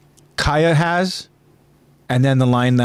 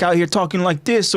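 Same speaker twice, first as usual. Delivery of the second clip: higher-pitched mock Jersey accent; 6 semitones higher than usual. Shown as a demonstration. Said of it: "stereotypical Jersey accent".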